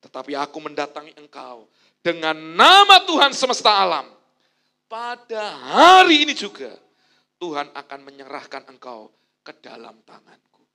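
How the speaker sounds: loud, ringing proclamation; bold declaration of faith. Retold or imitated. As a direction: proclaim it fearlessly. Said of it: "Daud berkata gini".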